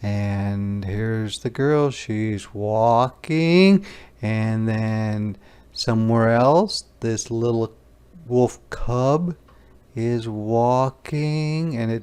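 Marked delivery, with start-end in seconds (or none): none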